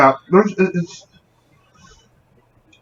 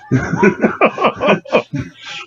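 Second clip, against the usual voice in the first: laughing. Real, not acted. Laughing at own story.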